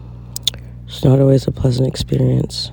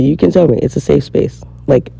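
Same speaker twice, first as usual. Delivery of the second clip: usual speaking voice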